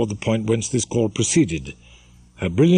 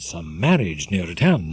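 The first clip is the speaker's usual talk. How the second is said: usual speaking voice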